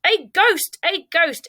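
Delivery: high-pitched alarm; panicked yelling. A narrator's reading. Townspeople see an empty dressing gown floating, yelling in panic. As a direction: cry out in a mild panic. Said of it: "with everybody yelling".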